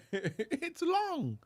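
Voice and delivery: high, airy falsetto plea; mock begging. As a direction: squeal it faintly like a comic beggar. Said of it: "sound like he was begging".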